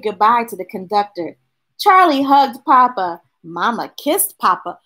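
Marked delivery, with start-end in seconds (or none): none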